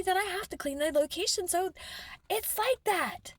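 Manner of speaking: rising toward shouting, indignant; angry rant. Real, not acted. Building rant about filthy locations.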